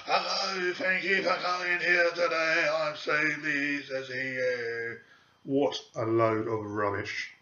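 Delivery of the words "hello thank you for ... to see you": breathy sigh, then a higher, airy, drawn-out greeting; mock a medium pretending to channel spirit; fake trance medium voice. Mocking a fake medium going into trance, airy and slow. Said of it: "and this is what they do".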